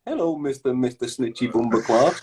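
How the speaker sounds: mock storybook greeting, read out sweetly; like a children's book voice greeting a cartoon character; mocking the rival as a Mister Men cartoon character; Mister Men narrator. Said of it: "throw them in a Mister Men book".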